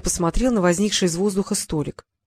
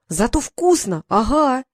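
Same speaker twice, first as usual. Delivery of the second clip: higher, carefree retort; dismissive boy; 5 semitones higher, slower than usual. A narrator's reading. Boy enjoying magic halva anyway, dismissive. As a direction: brush off the warning with relish. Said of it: "отмахнулся Халанбери".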